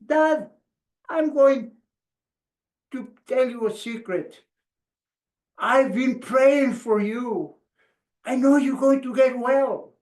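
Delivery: put-on high, small boyish voice, confiding; youngest son. Youngest son telling his sick dad a secret, high boyish voice. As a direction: play the little boy sharing a secret. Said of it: "the youngest one, says".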